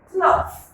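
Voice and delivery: cloth said high and clear for the class; model word. Shown as a demonstration. Teacher modelling a word for pupils, high and clear. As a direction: pitch "cloth" up and hold it clearly. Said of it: "Can you pronounce that word?".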